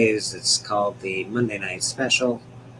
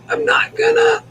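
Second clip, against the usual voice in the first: squeaky high-pitched shout; high yell; 8 dB louder than usual. Real, not acted. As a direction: yelp it out, way up high. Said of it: "I really wanna say a mama joke".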